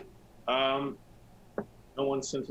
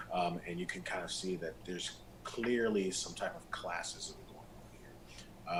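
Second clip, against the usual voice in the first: ordinary talk, nothing marked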